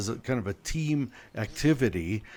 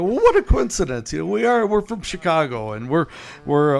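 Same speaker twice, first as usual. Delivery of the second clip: higher and louder, breezy; forced lightness; 4 semitones higher, 4 dB louder than usual. Retold or imitated. Visitor answering a hostile woman, forced cheer. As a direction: keep it light against a cold reception. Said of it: "trying to keep it light".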